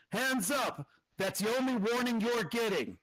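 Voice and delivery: high, forceful yell from cover; yelled warning. Acted. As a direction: yell it out as an armed challenge. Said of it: "someone yells out".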